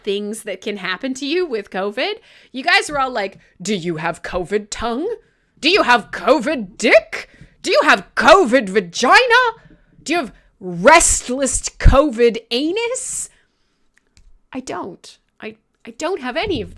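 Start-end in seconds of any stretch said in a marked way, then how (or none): 3.6-13.3 mimicking pestering questioners; mock interrogation. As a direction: pile on each question more emphatically, drawing out the last. Mock-quoting the chat's escalating questions.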